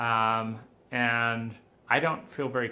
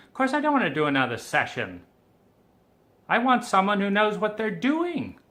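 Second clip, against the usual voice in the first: raised, loud and fed up; angry retort; 7 semitones higher, 6 dB louder than usual. Retold or imitated. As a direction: snap back in disbelief.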